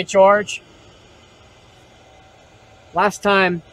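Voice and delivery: normal talking voice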